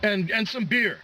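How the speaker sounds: high-pitched mock drunk demand; imitating drunk customer. Drunk passenger demanding whiskey from the clerk, high mock voice.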